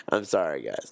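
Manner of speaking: ordinary talk, nothing marked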